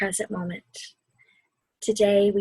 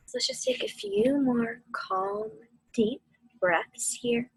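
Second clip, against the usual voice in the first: guided meditation. Acted. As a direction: ease them through the storm and back to calm.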